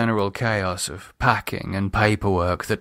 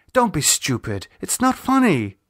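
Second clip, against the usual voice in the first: scolding; 7 semitones higher than usual. Acted. Lisa scolds David.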